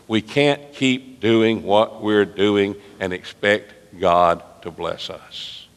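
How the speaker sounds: slow, weighted warning; a slow, grave sermon warning; slow emphasis. Real, not acted. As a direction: lean on every word slowly.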